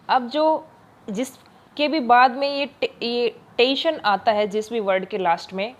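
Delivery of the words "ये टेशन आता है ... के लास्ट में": usual speaking voice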